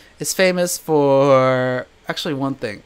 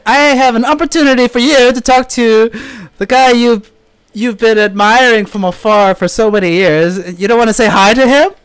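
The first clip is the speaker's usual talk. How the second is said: higher, louder coaxing; excited urging; 5 semitones higher, 5 dB louder than usual. Retold or imitated. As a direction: push her to take the chance. Urging girlfriend on phone.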